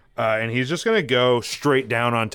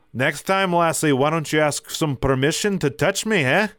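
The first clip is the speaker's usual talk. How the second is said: higher-pitched put-on Scottish scolding; Scottish accent; 4 semitones higher than usual. Kilted fighter scolds the one he punched, Scottish accent. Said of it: "let me get in my Scottish accent here".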